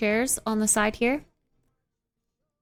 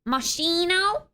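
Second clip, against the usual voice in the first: high, slow, rising question; puzzled question; 7 semitones higher, slower than usual. Real, not acted. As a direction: ask it in disbelief. Questioning the suggestion.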